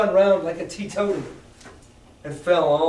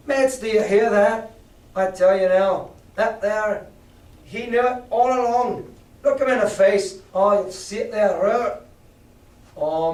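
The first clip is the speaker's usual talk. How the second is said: a touch of an angry rabble-rouser; 4 semitones higher, 3 dB louder than usual. Acted. Mary rallying crew against Silver.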